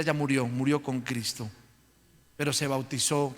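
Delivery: ordinary talk, nothing marked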